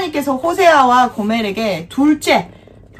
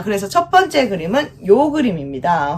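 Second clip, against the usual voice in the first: ordinary talk, nothing marked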